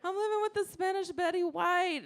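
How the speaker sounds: high-pitched, thrilled gush; mock-thrilled squeal, kept light; gushing excitement. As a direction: gush as if it's a dream come true. Retells gushing delight at housemate.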